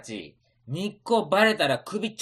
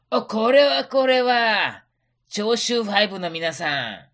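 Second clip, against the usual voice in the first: merchant voice; 4 semitones higher, 6 dB louder than usual. Acted. Merchant welcoming samurai.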